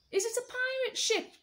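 hopeful and eager; eager question. Acted. George asking the parrot, eager and excited. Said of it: "George asked the bird".

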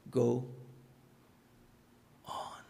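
"Go on" dropped to a soft, low echo. Shown as a demonstration. Preacher softly repeating the closing words to the church. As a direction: let it fall away gently. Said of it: "final quiet repeat".

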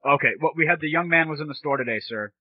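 ordinary delivery

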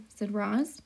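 usual speaking voice

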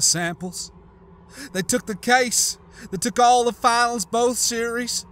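tearful. Acted. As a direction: tell it holding back tears. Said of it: "her eyes welling up with tears".

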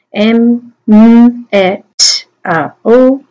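phonemes of metal, each isolated; "M, M, E, T, A, O" as speech sounds; sounding out. Shown as a demonstration. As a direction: hold each sound on its own. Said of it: "sound them out".

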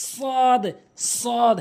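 সদ with a heavy s and long held vowel; tongue tip to teeth, stretch a full-mouthed সদ; correct সদ. Shown as a demonstration. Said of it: "সঠিক উচ্চারণ হচ্ছে".

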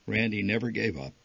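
ordinary delivery, nothing marked

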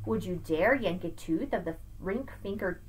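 lively sing-song picture-book read; reading to children. Acted. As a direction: read Seuss aloud to a child, bouncing the rhymes. Reads Dr. Seuss aloud.

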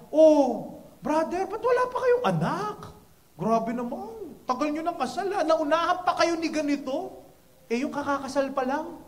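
put-on ribbing him about having no kids; teasing busybody. Nosy churchgoer teasing about no kids.

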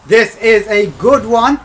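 will the pack to be good; drawn-out excited hope. Real, not acted.

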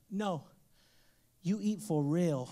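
slow, low little voice insisting; stern toddler. Retold or imitated. Toddler son orders dad to really eat the leaf, low and slow.

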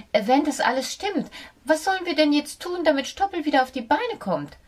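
hohe, schnelle, ratlose Mäusestimme; ratlos. A narrator's reading. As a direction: frag hilflos und hastig. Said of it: "fragte sie ratlos". (die Maus fragt Fox ratlos um Hilfe)

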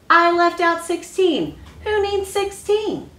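teacher at counting time mocking Alexander's mistake. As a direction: play the teacher making fun of his mistake. Teacher character scolds Alexander.

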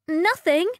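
high, loud evasive princess; quick guilty denial; cartoon princess. A narrator's reading. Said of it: "said the princess".

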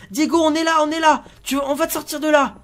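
loud, high rescue call. Pretend. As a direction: shout encouragement to a trapped child. Reassures trapped son from afar. (encouragements criés au fils prisonnier)

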